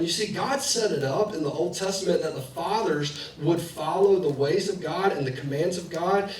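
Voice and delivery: ordinary talk, nothing marked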